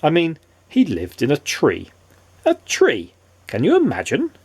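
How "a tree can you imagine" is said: 1 astonished. A narrator's reading. Narrator mock astonishment.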